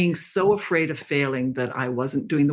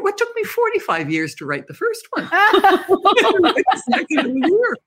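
laughing disbelief; 4 dB louder than usual. Real, not acted. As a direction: laugh out the absurd deadline.

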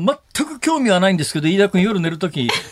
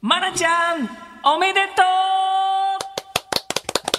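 warm congratulations. Real, not acted. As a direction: cheer her on gently. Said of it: "と言っていただけると嬉しいです".

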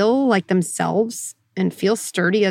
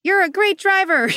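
high-pitched, peppy encouragement; mock cheerleading; 11 semitones higher than usual. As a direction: play an eager backseat cheerleader, pitched high. Backseat cheerleader voiced.

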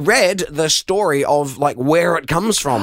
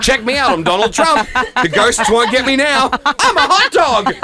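louder, higher playful bragging; excited costume boast; 7 semitones higher, 4 dB louder than usual. Pretend. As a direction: show off the costume gleefully. Voicing a costumed reveler.

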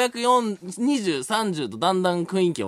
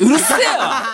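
laughing mock yell, pitched up; japanese delinquent tough talk; 8 semitones higher, 6 dB louder, slower than usual. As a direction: bark it back like a thug. Mock angry retort at listener.

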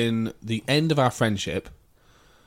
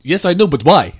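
raised and louder, baffled shopkeeper; mock Turkish shopkeeper; 5 semitones higher, 10 dB louder than usual. Kebab shop worker answering the girl, baffled mock accent. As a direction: ask why, puzzled and loud. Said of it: "he went".

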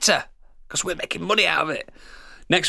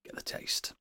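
unvoiced whisper; tempting whisper aside; 17 dB quieter than usual.

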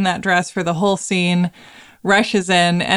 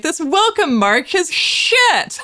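mock disdain; 10 semitones higher, slower than usual. Mozart dismisses Salieri's march.

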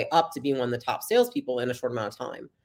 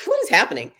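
high, louder incredulous question; disbelief; 10 semitones higher, 6 dB louder than usual. Retold or imitated. To her date proposing business together, a high incredulous outburst. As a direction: blurt it in disbelief.

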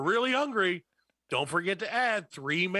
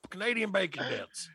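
macho man; 4 semitones higher than usual. Pretend. Mock macho bacon craver.

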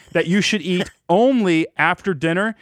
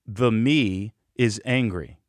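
calm self-talk; 6 semitones lower, 3 dB quieter, slower than usual. Shown as a demonstration. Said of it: "I say to myself".

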